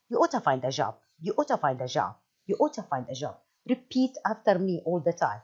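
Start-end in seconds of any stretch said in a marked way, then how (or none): 0.1-3.4 "ought to" blended as oughta, lower pitch; reduced oughta, repeated. Shown as a demonstration. Teacher drilling the linked form for students. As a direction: drill it smoothly, linking ought and to. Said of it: "you have to say".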